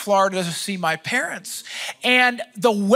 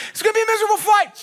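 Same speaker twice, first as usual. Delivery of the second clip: incredulous shout; 10 semitones higher, much faster than usual. Retold or imitated. Mocking the pilot's warning in disbelief.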